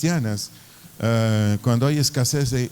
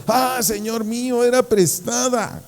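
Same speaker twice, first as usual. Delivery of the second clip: high, drawn-out cry of dismay; 10 semitones higher, 3 dB louder, slower than usual. Acted. Servant to the prophet over a borrowed axe, crying out. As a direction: cry out in alarm to your master. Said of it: "gritó diciendo".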